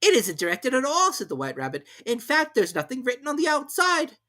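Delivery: high, loud hurried rabbit. A narrator's reading. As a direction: rush it as the rabbit.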